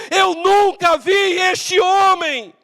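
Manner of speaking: high, slow, emphatic shout; sworn denial. Acted. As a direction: swear it out word by word. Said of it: "Jurou dizendo".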